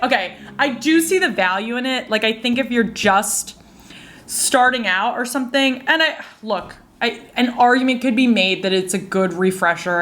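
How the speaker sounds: usual speaking voice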